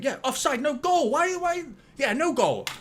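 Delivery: very high, excited outburst; excited vindication. Real, not acted. Fan learns the goal is offside, excited high cries. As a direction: let the voice leap up at the reprieve.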